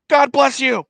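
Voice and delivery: high, loud mock holler; hammed-up shouted blessing; retold shouted blessing.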